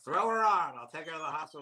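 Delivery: imitating the homeless guy. Comic retells the galloping man's offer, mock eager voice. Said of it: "he said something like".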